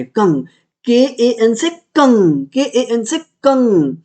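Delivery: के ए एन से कंग said slowly, letter by letter; slow spelling drill. Shown as a demonstration. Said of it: "ई एन होता है". (धीरे-धीरे spelling बोलते हुए)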